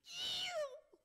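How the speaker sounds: very high, loud squeal of glee; imitated piercing cry of delight; child's delighted squeal. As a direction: squeal like a kid getting a present. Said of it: "little child gets the present and goes".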